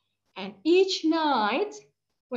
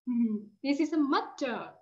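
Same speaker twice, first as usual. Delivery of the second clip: ordinary talk, nothing marked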